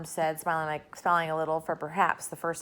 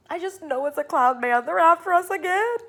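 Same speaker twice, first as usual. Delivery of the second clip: higher, louder frantic alarm; panicked; 10 semitones higher, 7 dB louder than usual. A narrator's reading. Miss Spider panicking about cloud men, frantic cry. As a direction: cry it out in terror.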